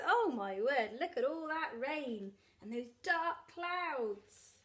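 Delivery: plain reading voice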